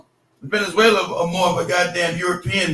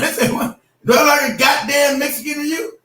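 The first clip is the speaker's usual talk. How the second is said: higher, indignant mock shout; offended retort; 8 semitones higher than usual. Retold offended Marine's reply.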